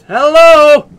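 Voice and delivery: very loud, high yell; big high-pitched shout; yelled hello. Real, not acted. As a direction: yell it into the dark house. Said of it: "Let's yell this shit".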